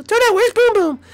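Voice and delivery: usual speaking voice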